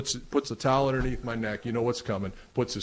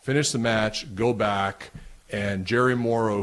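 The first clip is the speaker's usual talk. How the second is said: usual speaking voice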